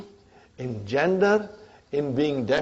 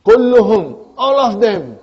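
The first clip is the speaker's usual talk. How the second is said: "Kulluhum, all of them" punched loud and high; emphatic; 3 semitones higher, 8 dB louder than usual. Shown as a demonstration. Teacher showing students how to emphasize that all came, emphatic. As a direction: hit "Kulluhum" hard, then "all of them", each falling firmly. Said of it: "Now I want to emphasize".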